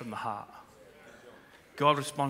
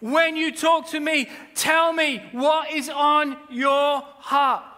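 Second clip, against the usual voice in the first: higher, louder, slow urgent call; passionate plea; 10 semitones higher, 5 dB louder, much slower than usual. Acted. Preacher voicing God's appeal to the church, urgently. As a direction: plead from the heart, nearly shouting. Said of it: "God is saying".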